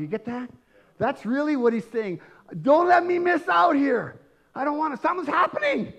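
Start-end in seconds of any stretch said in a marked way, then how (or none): 2.6-5.9 high, urgent cry of a man afraid to miss out; urgent plea. Acted. Preacher voicing the blind man, urgent high plea. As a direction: plead it urgently, pitched right up.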